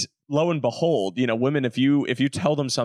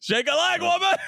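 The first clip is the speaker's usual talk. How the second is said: high-pitched joking holler; mock-bossy yell to hurry someone up; calling out. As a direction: echo the summons in a much higher voice. Joins in calling the partner.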